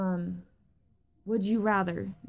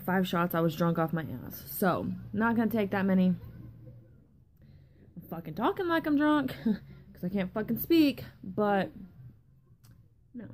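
ordinary talk

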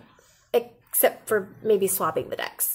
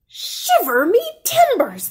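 pirate exclamation; 13 semitones higher, 4 dB louder than usual. A narrator's reading.

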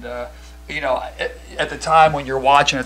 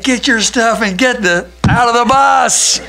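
high, loud shout to clear the bus; shouting orders; 11 semitones higher, 10 dB louder than usual. Retold or imitated. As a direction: bark it at the team to get off now. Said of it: "exact words that I used".

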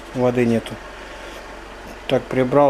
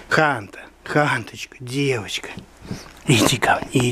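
ordinary delivery

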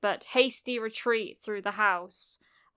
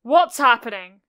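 loud, startled question; alarmed exclamation; 11 dB louder than usual. A narrator's reading. Auntie sees Izuku fading away, loud alarmed question. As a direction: blurt it out in alarm. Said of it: "Auntie exclaimed".

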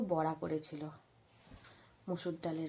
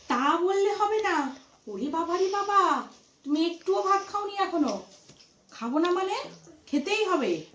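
playful scolding; 10 semitones higher, 5 dB louder than usual. Real, not acted. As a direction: pretend to scold her into eating. Aunt coaxing niece to eat.

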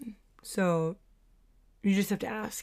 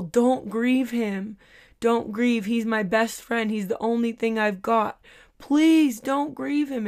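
loud pleading cry, louder and higher; retold pleading yell; 3 semitones higher, 5 dB louder than usual. Kathryn Kuhlman pleading loudly with the crowd at a meeting. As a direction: plead and yell at the crowd not to grieve him. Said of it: "screaming and yelling and pointing at people".

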